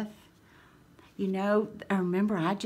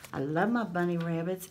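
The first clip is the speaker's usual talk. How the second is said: ordinary talk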